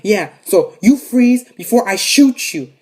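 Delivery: drawn-out mock menace; gunman's threat. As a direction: threaten slowly and forcefully as the robber. Gunman threatens grandma, retold.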